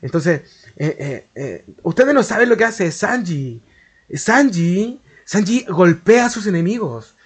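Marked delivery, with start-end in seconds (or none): 1.8-7.2 lightly mocking effeminate YouTuber voice, lilting higher; a hint of camp, drawn-out presenter tone; Don Maui. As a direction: imitate an affected, effeminate way of talking.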